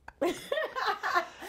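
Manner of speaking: high-pitched giggle; laugh. Real, not acted. Storyteller laughing at her ghost call, giggling.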